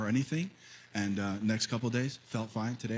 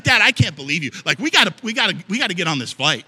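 loud, higher, impatient son; exasperated kid; 7 semitones higher, 12 dB louder, faster than usual. Retold or imitated. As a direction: complain to Dad, loud. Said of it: "He's like".

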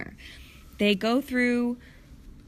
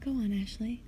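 ordinary delivery

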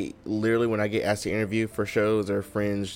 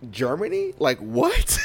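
higher, louder mock disbelief; incredulous; 7 semitones higher, 5 dB louder, slower than usual. Podcast host reacting to guest ending up in Germany, incredulous. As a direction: blurt it out, baffled and amused.

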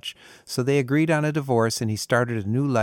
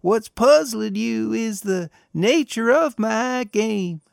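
much higher, slow, sly; sly old man half-singing a devilish riddle; 11 semitones higher, slower than usual. A narrator's reading. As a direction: tease it out slyly like the devil. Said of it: "in a very sly voice".

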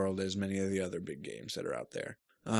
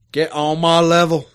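louder, higher, cocky comeback; playful boast; 7 semitones higher, 13 dB louder than usual. Real, not acted. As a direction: show off in jest. Playful boast after being teased.

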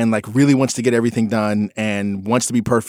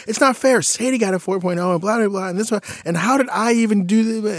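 higher, whiny complaint; upset daughter; 11 semitones higher than usual. Retold or imitated. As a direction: imitate the daughter protesting. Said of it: "And she was like".

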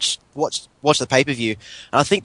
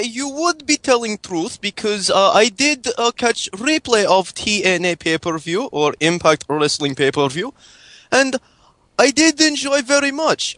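higher-pitched mock Russian accent with broken English; fake Russian accent; 6 semitones higher than usual. Prank caller phoning hosts in fake Russian accent. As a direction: play a hammed-up Russian caller. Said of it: "was a good Russian accent".

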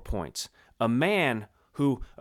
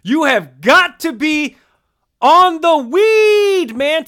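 high, loud, slow incredulous sports-pundit rant; Stephen A. Smith impression; 12 semitones higher, 14 dB louder, slower than usual. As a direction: stretch out the weed like an outraged TV pundit. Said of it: "to channel our Stephen A. Smith".

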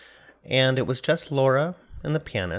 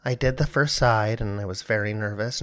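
normal talking voice

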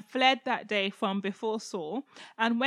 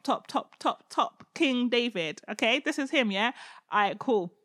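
usual speaking voice

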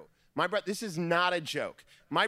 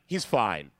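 unimpressed wife; 10 semitones lower than usual. Retold or imitated. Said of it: "And she's like".

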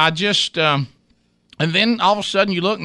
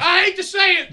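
emphatic outburst; 12 semitones higher than usual. Real, not acted. Repeats line loudly after cohost.

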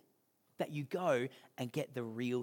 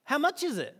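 put-on eager kid; 10 semitones higher, 3 dB louder than usual. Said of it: "And he's like".

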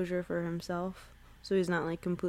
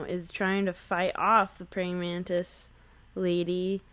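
ordinary talk

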